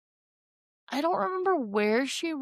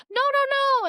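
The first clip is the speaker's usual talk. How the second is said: frantic denial; 4 dB louder than usual. Retold or imitated. Hurried denial in retelling.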